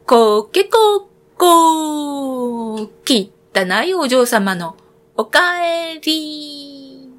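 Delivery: high, very slow stretched rooster call; mocking cock-a-doodle cry, drawn out; rooster crow. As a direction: crow out her shameful return. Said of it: "見て泣きました".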